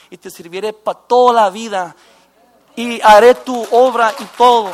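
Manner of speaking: loud, pleading cry to God; crying out a vow. Retold or imitated. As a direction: shout the promise like a desperate prayer. (clamando a gritos a Dios)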